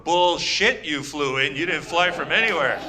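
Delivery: normal talking voice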